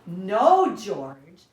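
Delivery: loud sharp no; mother scolding. Acted. Mother correcting her puppy, loud scolding. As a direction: stop him with a loud no. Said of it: "said George's mother".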